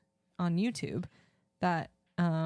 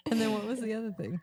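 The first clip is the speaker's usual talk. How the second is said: usual speaking voice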